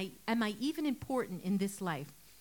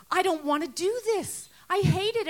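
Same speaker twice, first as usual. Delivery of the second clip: higher and louder, a distressed protest; upset outburst; 7 semitones higher, 6 dB louder than usual. Retold or imitated. Student telling the preacher she hates her studies, upset cry. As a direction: push the words out like she can't take it anymore.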